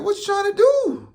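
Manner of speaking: heated high challenge to the man; challenging. Retold or imitated. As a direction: push him with the question. Ready to fight.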